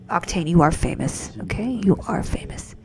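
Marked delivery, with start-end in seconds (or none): none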